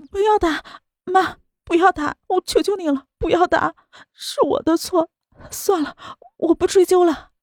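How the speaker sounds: high, tearful begging; crying plea. A narrator's reading. Daughter begging her mother not to call, crying. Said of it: "江婉竹却哭着道".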